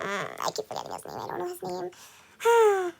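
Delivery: whispered, slow, whiny frustration; breathy exasperated whisper at forgetting; frustrated whisper. Real, not acted. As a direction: moan it under your breath, stuck on the name. Frustrated whining over forgotten name.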